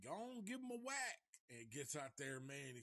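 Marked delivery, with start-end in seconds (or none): 0.0-1.2 put-on high, cackling old-timer urging; imitating old man. The old shopkeeper urges Hopper to try the saw, high and giddy. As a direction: egg him on like a giddy old codger. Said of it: "the old man's like".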